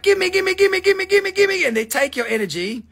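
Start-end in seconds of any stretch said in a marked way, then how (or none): 0.0-1.6 high, loud chant of gimme; mock pushy kids crowding you, pitched way up; greedy begging kids. Mimicking street kids begging tourists, high and loud. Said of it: "they're like".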